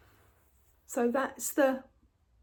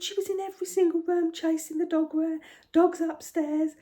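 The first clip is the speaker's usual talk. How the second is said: louder and higher, tumbling out; excited retelling; 6 semitones higher, 5 dB louder than usual. Retold or imitated. Telling the husband about the mayhem, excitedly louder. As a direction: build the chaos as you list it.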